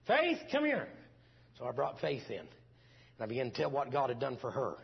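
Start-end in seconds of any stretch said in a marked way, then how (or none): 0.1-0.9 high, loud call across the house. Retold or imitated. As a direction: call the boy in from another room. Calling daughter into the room.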